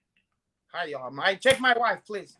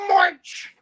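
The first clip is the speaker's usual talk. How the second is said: shouted, much higher and louder, barked order; mock shout; 12 semitones higher, 8 dB louder than usual. Imitating a mob boss ordering abort, mock shout. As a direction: yell it like a furious boss. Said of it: "He go".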